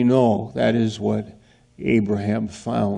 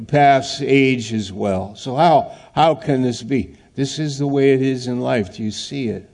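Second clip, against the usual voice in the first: ordinary talk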